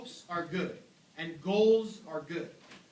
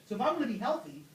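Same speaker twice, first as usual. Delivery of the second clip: normal talking voice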